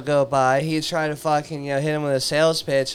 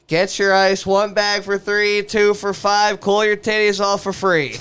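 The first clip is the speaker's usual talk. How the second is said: higher, louder, slow hawker's call; megaphone sales pitch; 5 semitones higher, 4 dB louder than usual. Retold or imitated. Ice seller calling out to passing women, loud hawking. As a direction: bark it out like a street vendor selling ice. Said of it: "on this megaphone".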